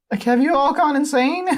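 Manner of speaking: high, loud squeaky outburst; shocked boy. Acted. Dipper reacting to the dating news, high incredulous voice. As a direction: pitch it right up in disbelief.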